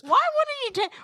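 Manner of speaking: high-pitched incredulous protest. Real, not acted. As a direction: cut in, voice leaping up in disbelief. Interrupts with incredulous challenge.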